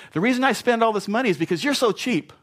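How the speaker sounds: hammed-up defensive spouse; mock blaming excuse. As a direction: toss the blame with a sneer.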